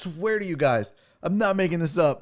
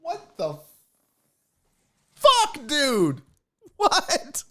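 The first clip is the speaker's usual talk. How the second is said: shocked; 11 semitones higher, much slower than usual. Retold or imitated. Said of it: "I was like".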